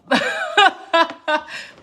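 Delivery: high, loud burst of laughter. Real, not acted. Storyteller pauses mid-tale, laughing out loud.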